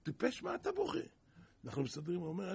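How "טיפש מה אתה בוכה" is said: high, scornful "why are you crying"; mocking scold. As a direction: tease him sharply, pitch jumping up. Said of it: "התחיל לבכות".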